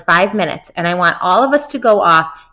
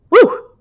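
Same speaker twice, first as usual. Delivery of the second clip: high, loud whoop of relief; sudden pitched woo; excited woo; 13 semitones higher, 4 dB louder than usual. Real, not acted. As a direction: whoop after a hard twister. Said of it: "This is a tough one".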